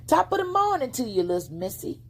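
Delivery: high, quick sly fox greeting; fox character voice. A narrator's reading. Fox greeting a little girl on the road, sly and high. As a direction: greet her like a smooth gentleman fox. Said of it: "the critter replied".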